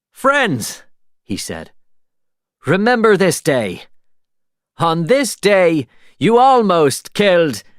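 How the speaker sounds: high, loud theatrical captain; grand pirate speech. A narrator's reading. Jack addressing the townspeople before escaping, theatrical. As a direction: proclaim it to the crowd.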